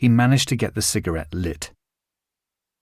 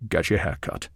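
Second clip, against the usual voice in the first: firm low Connery order; 5 semitones lower, 6 dB quieter than usual. A narrator's reading.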